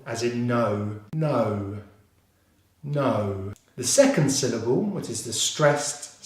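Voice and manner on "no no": "no" alone, lower, with a gliding O; O diphthong. Shown as a demonstration. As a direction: draw out the O glide in "no". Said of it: "followed by the O vowel diphthong".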